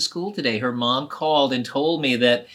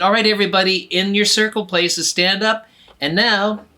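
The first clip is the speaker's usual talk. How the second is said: higher, louder bossy teacher; 5 semitones higher, 6 dB louder than usual. Acted. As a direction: call the class to order. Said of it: "And then the teacher said".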